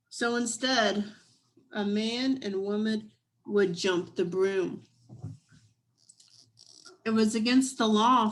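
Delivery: lively storybook reading voice; story narration. A narrator's reading. Reading a picture book aloud.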